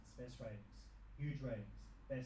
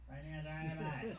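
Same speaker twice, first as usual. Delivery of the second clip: broad mock Australian accent, higher; mock Aussie accent; 4 semitones higher, 5 dB louder than usual. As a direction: ham up an Aussie bloke accent.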